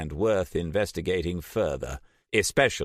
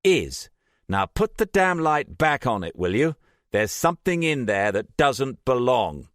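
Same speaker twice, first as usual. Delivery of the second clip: higher, louder sharp order; impatient command; 8 semitones higher, 5 dB louder than usual. A narrator's reading. Sarah snapping at Trish.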